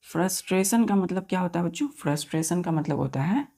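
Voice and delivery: ordinary delivery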